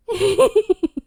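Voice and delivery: high loud fangirl laugh; giddy laugh. Real, not acted. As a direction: burst into an embarrassed giggle. Laughs at own fandom.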